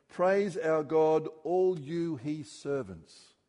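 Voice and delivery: slow, loud call to praise; proclaiming. Acted. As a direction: call the servants to praise, drawn out. Voice from throne quoted.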